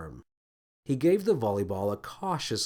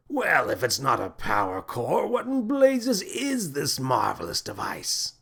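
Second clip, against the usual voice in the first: lightly pompous alien captain; amazed captain; 3 semitones higher than usual. A narrator's reading. Captain marvels at ball.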